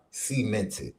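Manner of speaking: usual speaking voice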